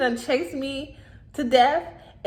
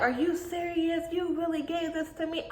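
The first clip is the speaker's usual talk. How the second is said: put-on upset kid; 6 semitones higher, 6 dB quieter than usual. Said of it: "I'm going off".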